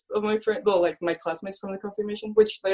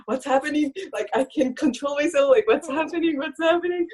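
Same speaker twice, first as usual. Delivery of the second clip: rising higher with each line, laughing helplessly; can't stop giggling and can't understand why; climbing pitch, half laughing, half crying; giddy bewilderment; 8 semitones higher than usual. Retold or imitated. Laughing uncontrollably in church.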